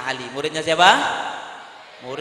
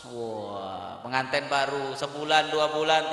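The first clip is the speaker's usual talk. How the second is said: ordinary delivery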